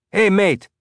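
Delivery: calling out loudly across the site; high, loud shout to a mate. A narrator's reading. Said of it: "Coop shouted".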